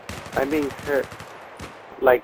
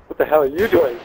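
ordinary talk, nothing marked

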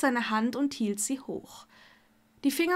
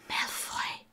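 whispered in dismay; hushed, disturbed whisper; distraught whisper; 8 dB quieter than usual. A narrator's reading. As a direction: whisper the name, unsettled. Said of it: "flüsterte Hermine verstört".

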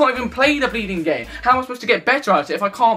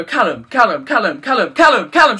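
calling name, building to a shout; 5 dB louder than usual. Retold or imitated. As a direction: keep calling Callum, louder each time until the last one is a yell. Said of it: "shouting at me like that".

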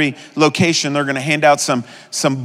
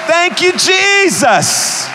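shouted praise; 12 semitones higher, much slower than usual. Shown as a demonstration. Pastor modelling praise for the congregation, high shout. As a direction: cry out thanks to Jesus at full voice. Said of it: "just shout".

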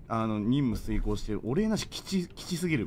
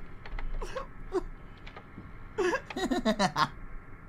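wheezing high-pitched laughter; high breathless laugh; 6 dB quieter than usual. Real, not acted. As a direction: laugh until the voice cracks. Breaks down laughing at himself.

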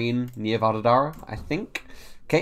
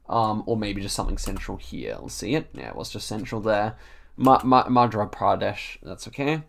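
usual speaking voice

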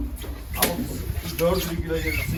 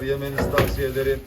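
ordinary delivery

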